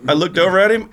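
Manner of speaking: usual speaking voice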